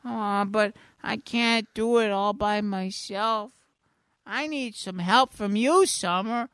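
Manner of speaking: high, slow forlorn cartoon voice; pitiful squeaky character; sad Mister Poopy Butthole. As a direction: plead slowly in a high mock-sad voice. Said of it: "he looks real sad right here".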